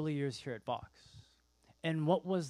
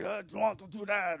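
put-on light German accent, a bit higher; mock German accent; 5 semitones higher than usual. German rider refusing, in a mild mock German accent. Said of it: "He's German".

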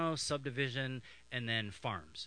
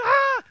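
short high, loud shriek; imitated scream of a terrified sister; mock scream; 16 dB louder than usual. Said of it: "She's like, screaming".

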